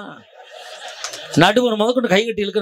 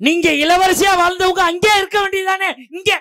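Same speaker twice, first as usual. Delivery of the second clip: high, raised-voice heckle; heated retort; 10 semitones higher than usual. Real, not acted. Husband challenging the wives' debater, heated retort. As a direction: argue back at a much higher pitch.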